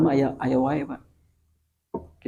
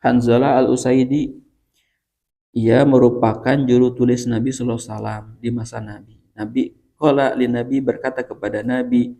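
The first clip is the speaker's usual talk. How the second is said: ordinary talk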